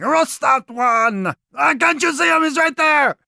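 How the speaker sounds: high, loud frantic yell; shouting accusations. Acted. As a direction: point and shout at the wrong guy. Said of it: "and saying".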